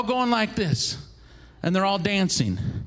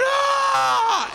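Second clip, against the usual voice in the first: long high mock scream; drawn-out high cry; labor scream; 12 semitones higher than usual. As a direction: wail in labor pain. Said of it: "And she's going".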